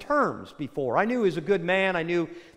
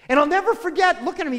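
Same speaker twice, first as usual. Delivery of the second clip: ordinary talk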